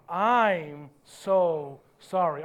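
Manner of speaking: slow, low, firm apology. Shown as a demonstration. Preacher leads congregation again, with firmer conviction. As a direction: draw out each word with conviction. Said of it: "Let's say it with more conviction".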